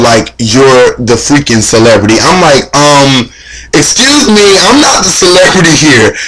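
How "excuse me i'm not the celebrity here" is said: teary. Retold or imitated. Fan overwhelmed by [name].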